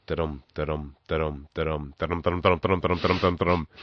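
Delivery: rhythmic da-dum of wheels over tile joints; trolley rhythm. Retold or imitated. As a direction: tap out the bump of the trolley wheels. Imitating trolley wheels over tiles.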